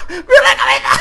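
high crying shout at the head; shocked cry. Real, not acted. Gamer shrieking at the monster head on screen.

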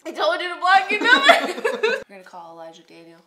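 ordinary talk

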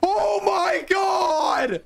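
high, slow, ecstatic scream; real euphoric yell. Real, not acted. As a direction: shriek in disbelief. Surviving a close fight.

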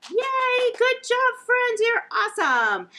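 put-on high, loud cheering for the kids; excited praise. Librarian cheering the children after the song, high and loud. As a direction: cheer them on like a proud teacher.

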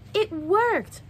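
high, loud happy cry; thrilled it worked; delighted exclaim. Acted. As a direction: burst out with joy. Surprise at balloon success.

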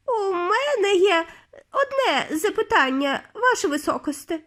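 quavery high plea for attention; witch in the crowd. A narrator's reading. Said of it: "пролунав чийсь голос".

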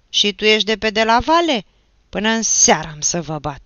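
loud, high mocking question turning to threat; angry shouting; furious threat. A narrator's reading. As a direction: round on the next boy in rage. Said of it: "și mai furios".

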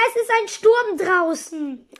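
ordinary delivery

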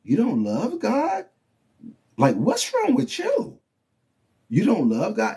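incredulous challenge. Retold or imitated. Believers taunting the sinner, high and incredulous. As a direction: lean in, baffled and teasing. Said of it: "That's what we say to the sinner man".

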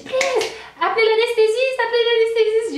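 high, loud pleading cry for the anesthetist; desperate plea. Retold or imitated. Woman in labour begging her husband, high desperate cry. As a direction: beg for the anesthetist at the top of the voice.

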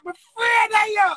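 frightened outcry. Real, not acted. Confessing fear to rivals.

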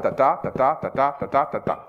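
ta ta, ta ta beaten out in iambs, weak then strong; iambic rhythm. Shown as a demonstration. Director demonstrating iambic beat to audience, chanted rhythm. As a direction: tap out the pentameter beat. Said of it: "cinq fois deux syllabes".

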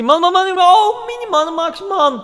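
high, loud goal celebration yelling; ecstatic shouting. Real, not acted. Gamer yelling at his own goal, ecstatic. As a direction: scream with joy at the goal.

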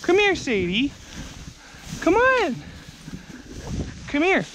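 high coaxing calls to the dog. Real, not acted. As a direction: coax her gently but high.

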